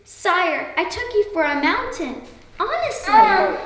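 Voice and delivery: a frightened mouse squeaking up at a king; timid squeak. A narrator's reading. Mouse pleading with the lion who caught him, high timid squeak. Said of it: "barely enough courage to squeak".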